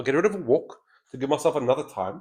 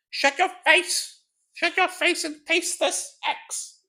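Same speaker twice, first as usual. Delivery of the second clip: axe-wielding brute voice; slower than usual. Acted. Player voicing the axe-wielding enemy, high mock snarl. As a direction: shriek the threat like a wild axe fighter. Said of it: "that's how she sounds".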